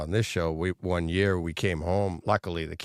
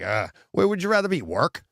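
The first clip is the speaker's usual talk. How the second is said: mock sarcastic question; 10 semitones higher, 3 dB louder than usual. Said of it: "It's just like".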